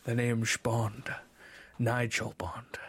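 slow Scottish-tinged Connery voice, last words breathed; Sean Connery impression. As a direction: purr it like Sean Connery, slowly. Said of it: "Can you do ... as Sean Connery?".

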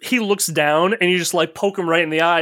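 normal talking voice

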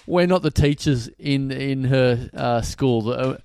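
ordinary talk, nothing marked

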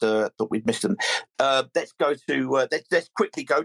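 ordinary talk

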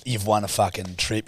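ordinary talk, nothing marked

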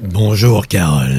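low, deep ghostly greeting; voice from beyond the grave. Acted. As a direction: speak like a voice from the tomb. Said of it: "Avec ta voix d'outre-tombe". (voix d'outre-tombe grave et caverneuse)

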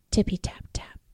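soft whisper of rain. Acted. Reading about rain to children, whispering. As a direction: whisper it like raindrops.

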